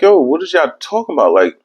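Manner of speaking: high baffled protest rising on straight; mock bewildered insistence; confused protest. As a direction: insist you're upright, confused. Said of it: "I'm like".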